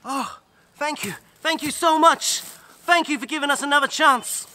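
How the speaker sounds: high, loud gushing gratitude; girl thanking the earth, high and loud with relief; overjoyed thanks. Acted.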